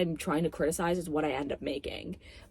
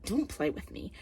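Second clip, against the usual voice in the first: usual speaking voice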